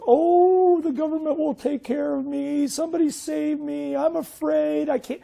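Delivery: high, whimpering mock victim pleading to be saved; whiny helpless voice. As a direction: play the helpless one who wants rescuing, high-pitched. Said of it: "How about this little voice?".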